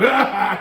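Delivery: short loud laugh burst; a quick loud ha. Acted. Elephant hoes and ha-has, a quick loud burst of laughter. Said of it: "He hoed and he ha-ha".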